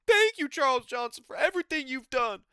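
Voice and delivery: high, loud overblown gratitude; sarcastic thank-you speech; mock grand thanks. Real, not acted. Fan thanking the fighter, high and overblown.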